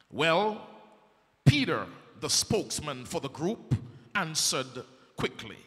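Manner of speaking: ordinary talk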